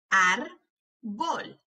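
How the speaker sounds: "Árbol" slowly as ár, pause, bol; broken into syllables. Shown as a demonstration. Teacher helping hear each sound, syllable by syllable. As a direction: break "Árbol" in two. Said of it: "quiero que las quiebres entre sílabas".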